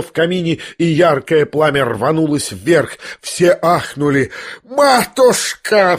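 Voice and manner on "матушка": high, long-drawn cry; acted wail. A narrator's reading. Lebedev begs Nastasya by the fire, wailing. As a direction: wail it out slowly on a high pitch. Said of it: "всё ещё вопил Лебедев".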